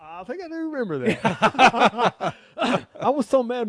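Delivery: chuckling as he admits he remembers; amused laugh while answering. Real, not acted. Laughs recalling the story.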